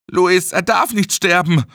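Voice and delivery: high, desperate plea; panicked pleading. A narrator's reading. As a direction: plead for a friend's life. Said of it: "Kyles Augen waren panisch geweitet". (panisches Flehen)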